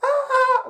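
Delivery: startled high scream; waking scream. Retold or imitated. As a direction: jolt awake with a shriek. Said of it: "like, screaming".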